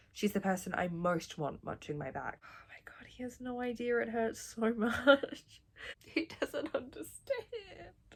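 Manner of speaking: hushed, overcome by feeling; emotional whisper. Real, not acted. Moved whisper over scene.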